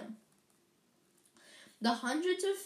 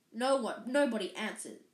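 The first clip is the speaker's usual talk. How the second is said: usual speaking voice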